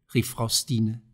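usual speaking voice

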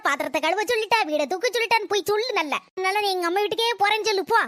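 ordinary delivery

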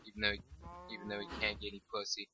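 usual speaking voice

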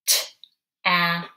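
"T-ap" split into T then "ap", whispered; sounded out. Shown as a demonstration. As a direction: stretch "T-ap" into two sound chunks. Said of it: "we are going to make is the word".

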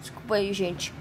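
after choking. Real, not acted.

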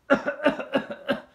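loud, open cough into the air; demo cough. Shown as a demonstration. Teacher demonstrating the wrong way to cough, loud open cough. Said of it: "Así como lo estoy haciendo".

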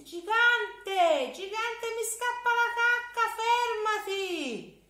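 very high, loud, whining plea; a child pretending he desperately needs the toilet; boy begging the giant to stop; pleading little boy.

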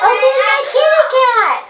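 high, loud delighted squeal; a burst of joy at seeing her pet; excited squeal. Real, not acted. Child spots her kitty cat in the game, squealing with excitement.

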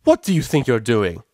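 loud, indignant yell; screamed challenge. A narrator's reading. Mare challenging colts crowding her friend, screamed. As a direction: shout them down from afar. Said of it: "she screamed".

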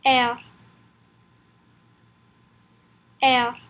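French letter R, said "Air" with a French r; "Air" modelled in French for learners; French pronunciation drill. Shown as a demonstration. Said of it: "Practice saying it with me".

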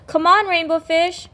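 high, bright fish voices calling. Acted. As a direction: call him over to play.

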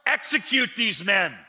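high, loud ruler's order; stern command. Retold or imitated. Joseph imagined ordering his guards, a loud high command. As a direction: bark it out like a ruler. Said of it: "to just say".

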